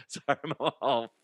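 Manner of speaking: ordinary delivery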